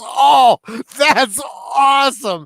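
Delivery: big stretched-out burst of delight, voice way up; delighted reaction. Retold or imitated. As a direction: gush like a thrilled celebrity, imitated. Retelling actor's delighted reaction.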